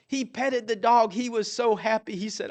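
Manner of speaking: usual speaking voice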